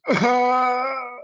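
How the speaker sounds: a short, high burst of laughter; laughing at his own mockery of the critics. Real, not acted. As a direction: crack up at his own impression.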